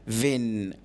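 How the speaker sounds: ordinary talk, nothing marked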